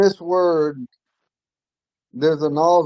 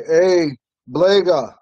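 Hey, Blega with the first A said louder and higher; louder first syllable; 3 semitones higher, 4 dB louder, much slower than usual. Shown as a demonstration. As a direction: stress the accented A of Blega. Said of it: "So if you say".

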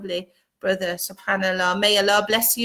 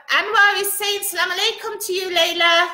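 raised, much higher calling voice; 8 semitones higher than usual. Real, not acted. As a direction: relay it to your daughter across the room. Relaying greeting to daughter farther away.

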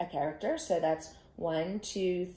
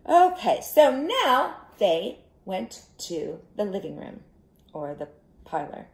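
plain reading voice

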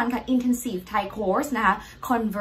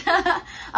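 embarrassed giggle; 9 semitones higher than usual. Real, not acted. Laughs after answering.

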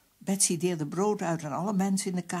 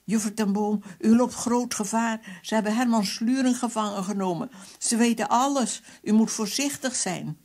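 high, loud, alarmed telephone warning; urgent babbling woman; 6 semitones higher, 6 dB louder than usual. A narrator's reading. Woman caller warning of great danger, urgent babbling. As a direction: rattle on in high-pitched alarm. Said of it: "De vrouwenstem babbelde maar door".

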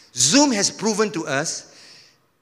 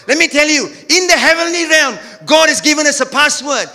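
loud and high, climaxing; preaching shout; 8 semitones higher, 4 dB louder than usual. Real, not acted. Pastor to church, proclaiming loudly. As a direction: drive it home to the crowd.

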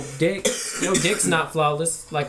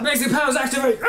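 hammy power call; 7 semitones higher, much faster than usual. Pretend. Mock superhero power call.

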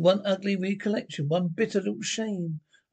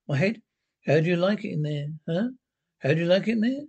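ordinary talk

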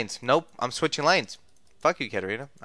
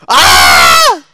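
loud startled scream; real scream; 8 dB louder than usual. Real, not acted. Gamer caught out in game, screaming.

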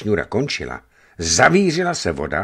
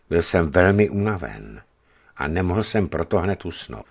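ordinary delivery, nothing marked

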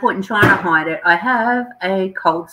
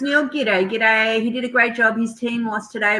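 ordinary delivery

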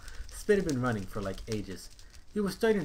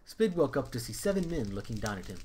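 usual speaking voice

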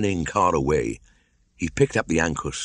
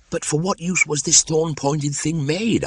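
put-on high, eager boyish question; curious boy; 12 semitones higher than usual. Mowgli asking Bagheera about the ankus, curious. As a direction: ask like a wide-eyed boy.

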